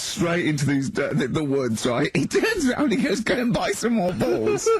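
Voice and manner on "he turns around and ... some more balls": high-pitched, giggling retelling; squeaky with real amusement; laughing. Real, not acted. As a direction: barely hold in the laugh as you quote him. Cracking up at friend's bad shot.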